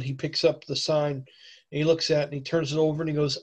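ordinary talk, nothing marked

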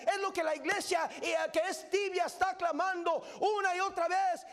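fiery revival preaching; preaching at full force. Acted. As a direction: preach it to the back row. Preacher's climax, shouted.

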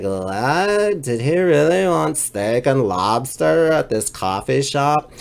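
mock the staff wondering what that order was; baffled baristas. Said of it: "they're just standing there like".

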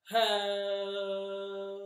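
long stretched-out hello; slow hello. Shown as a demonstration. Kids' game, saying hello slowly. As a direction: drag it out slowly. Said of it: "say hello as slow as we can".

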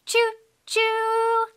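high, sustained train hoot; train whistle imitation. Shown as a demonstration. Showing a toddler a train sound, high whistle. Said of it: "Can you do that?".

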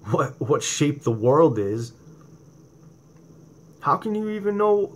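ordinary delivery

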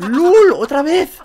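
high, loud burst of glee; excited cheer. Real, not acted. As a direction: cheer the second knock. Celebrating a knock.

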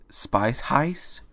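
a startled echo of the words; timid shocked question. Acted. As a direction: gasp it out in disbelief. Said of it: "Fluttershy said in shock".

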